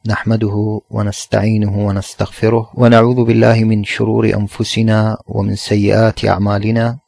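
a calm, dignified opening prayer, held low; formal opening invocation. Acted. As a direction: recite it like a sermon preface, even and measured. Opening formula before the lesson.

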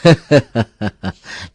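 good-natured chuckle; easy, forgiving laughter; hearty laugh. A narrator's reading. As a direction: laugh off the false alarm. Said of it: "Ông Bảy Quá cười xòa".

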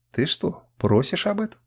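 a curious question rising high; interested. A narrator's reading. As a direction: perk up, intrigued. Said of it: "заинтересованно спросил Камчак". (заинтересованно, с подъёмом тона вверх)

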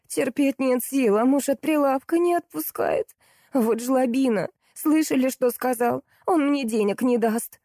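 high, drawn-out complaining; whining. A narrator's reading. Salesgirl complains about her husband, whining. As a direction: whine your grievances in a pitiful high voice. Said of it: "заныла девушка".

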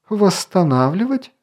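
high, rising "Восстанавливать?"; incredulous exclamation. A narrator's reading. Elf challenges the king's plan, incredulous exclamation. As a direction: throw the word back in disbelief. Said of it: "воскликнул Орелий".

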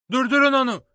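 high, loud urgent yell; shouting stop him. A narrator's reading. Boy yelling at the inn crowd to stop the fleeing man, full shout. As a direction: yell it out across the inn, sharply. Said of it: "diye bağırdım".

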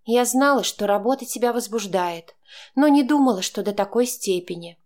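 mocking girlfriend voice. A narrator's reading. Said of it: "насмешливо прошептала Янка".

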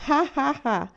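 a high, loud, jeering ha ha ha; mocking laugh. A narrator's reading. Jerry mocking his scared sisters with a laugh. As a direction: laugh at them to rub it in. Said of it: "li prese in giro Jerry".

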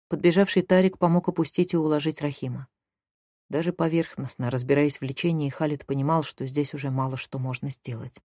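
usual speaking voice

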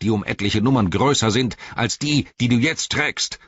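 Saruman's rage swells into a scream; swelling to a scream. A narrator's reading. Saruman screaming at Gandalf in rage, high mocking yell. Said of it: "seine Stimme schwoll zu einem Schrei an".

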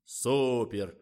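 bright delighted exclamation. A narrator's reading. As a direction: marvel at it out loud. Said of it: "восхитился Николай".

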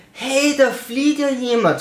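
high, slow, dopey call-out; mock dim soldier. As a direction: play a clueless guard noticing late. Mocking soldiers noticing escape. (hoch und langsam, dümmlich-verdutzt)

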